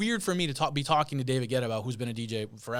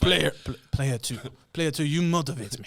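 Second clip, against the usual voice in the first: mock video-game voice with a French accent; Street Fighter character; 2 semitones higher, 4 dB louder than usual. Playing along with the game joke, hammed-up Street Fighter voice. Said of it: "like a Street Fighter".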